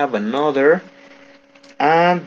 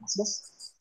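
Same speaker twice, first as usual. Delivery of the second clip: normal talking voice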